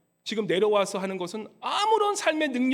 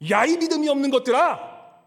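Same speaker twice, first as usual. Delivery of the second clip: louder, shouted rebuke; furious shout; 4 semitones higher, 7 dB louder than usual. Acted. Jesus shouting at the faithless generation, angry. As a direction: yell the rebuke at full force. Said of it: "그 모습을 보면서 주님께서는".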